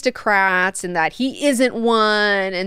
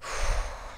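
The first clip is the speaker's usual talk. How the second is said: long, voiceless acted sigh; big sigh; 10 dB quieter than usual. Leto tired of explaining to Duncan, big sigh. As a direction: let out a big breath of exasperation. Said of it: "Leto has a big internal sigh".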